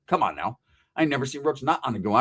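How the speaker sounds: ordinary talk, nothing marked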